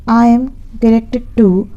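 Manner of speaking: very slow, word by word; slow dictation. Shown as a demonstration. Teacher dictates the English phrase to students slowly. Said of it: "dictating slowly".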